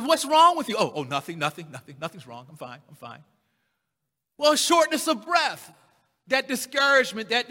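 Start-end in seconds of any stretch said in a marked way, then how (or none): 0.0-0.8 higher, louder concerned wife; a wife pressing her husband what's wrong; wife asking. Retold or imitated. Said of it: "she says". 0.8-3.2 low, fading mumble of nothing; dejected denial. Retold or imitated. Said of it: "she says".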